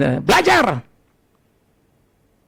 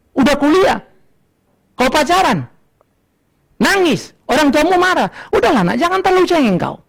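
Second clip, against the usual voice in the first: usual speaking voice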